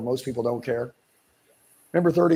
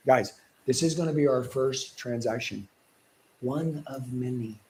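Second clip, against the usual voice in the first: slow, firm closing with a softer trailing phrase; authority voice; 5 dB quieter than usual. Shown as a demonstration. Coach demonstrating authority to sales trainees, firm and slow. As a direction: talk down as the one in charge. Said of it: "like I'm the, the authority".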